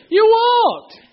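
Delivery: put-on very high, loud cheer to a toddler; delighted parent cheer. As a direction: cheer the baby's first steps. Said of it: "There's like a party".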